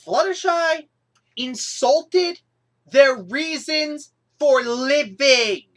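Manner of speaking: Fluttershy insulted their reasons for living, stressed; emphatic outrage. Real, not acted. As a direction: spell out each word in disbelief.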